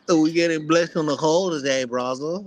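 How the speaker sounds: a hint of a mocking British accent. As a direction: tease them in a British accent. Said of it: "Y'all got that dumb British accent".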